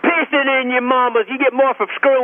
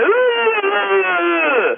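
mock moan; 7 semitones higher than usual. Mocking the mother's moan.

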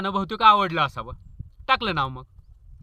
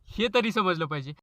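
normal talking voice

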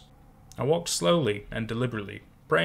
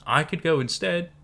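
wavering, unsteady offer; shaky voice; 6 semitones higher than usual. A narrator's reading. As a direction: let the voice tremble a little. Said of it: "voice shaky".

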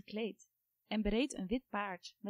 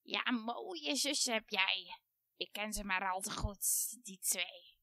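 hissing witch; slower than usual. A narrator's reading. Baba Yaga sneers at the girl about her sisters, hissing. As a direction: hiss it out like an old witch. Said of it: "siste Baba Yaga".

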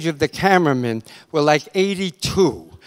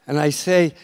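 ordinary talk

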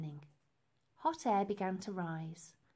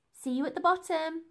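called up; 8 semitones higher, 6 dB louder than usual. Acted. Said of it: "she called up".

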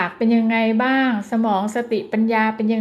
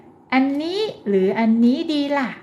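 slow, higher, simple choice question; 3 semitones higher, slower than usual. Shown as a demonstration. Therapist offers a toddler a choice, slow and higher. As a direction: ask it slowly with short words. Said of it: "ช้าลงนิดนึง เป็นคำศัพท์สั้นๆ".

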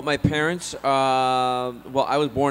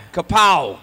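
sudden, loud, higher exclamation; playful shout; 7 semitones higher, 11 dB louder than usual. Pretend. Joking about Klingon friends, playful shout. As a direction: shout it out like a comic-book blow.

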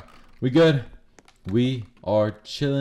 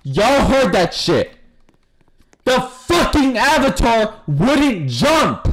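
exasperated yelling; 10 semitones higher, 6 dB louder, slower than usual. Real, not acted. Exploit jump panic.

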